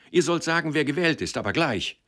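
high, pushy demand; insist, get it now; calling out. A narrator's reading. Boy demanding the porter tell him at once, high call. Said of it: "rief er den Pförtner an".

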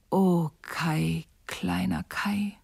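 slow sighed whisper; longing sigh, slow and whispered. Acted. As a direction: sigh his name longingly. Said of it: "seufzte Gerda".

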